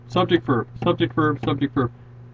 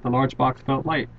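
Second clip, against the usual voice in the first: "felt" said a bit softer; past tense; 2 semitones lower, 6 dB quieter than usual. Shown as a demonstration. Teacher to class, softly reading the past-tense example. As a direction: read out softly as the past form. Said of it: "this is how I would say it".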